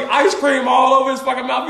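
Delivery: ordinary delivery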